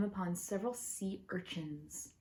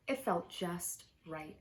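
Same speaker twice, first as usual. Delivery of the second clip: ordinary delivery, nothing marked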